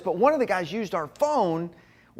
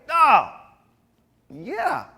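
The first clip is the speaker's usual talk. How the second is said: high, loud "oh", then high agreement; mock eager agreement; 8 semitones higher, 8 dB louder than usual. Boys answering the leader at dinner, mock eager agreement. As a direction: play teenage boys agreeing too readily.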